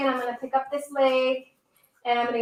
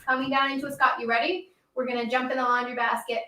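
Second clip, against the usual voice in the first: ordinary talk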